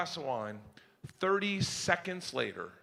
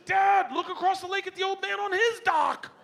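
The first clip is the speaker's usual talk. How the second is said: daughter voice; 12 semitones higher, 6 dB louder than usual. Retold or imitated. Daughter's voice, retold.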